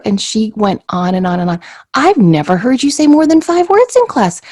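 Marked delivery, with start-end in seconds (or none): 1.9-4.4 high, loud, gushing praise from the teacher. Retold or imitated. Teacher gushes at the girl in the restaurant, high and loud. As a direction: gush over the child like an overexcited teacher.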